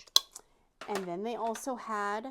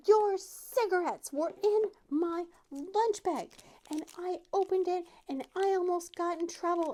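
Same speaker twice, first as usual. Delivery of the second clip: put-on outraged child; 11 semitones higher, 6 dB louder than usual. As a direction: pile the blame on mom, high and loud. Child confronts mom.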